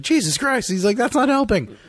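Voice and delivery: high, loud frightened squeal; panicked coworker. Retold or imitated. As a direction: yelp it like a terrified flyer. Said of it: "And he's just like".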